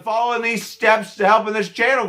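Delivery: high, loud announcer pitch; promo hype. Real, not acted. Pushing viewers to support the channel, loud hype. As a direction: sell it like a hype man. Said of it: "switch to channel promo".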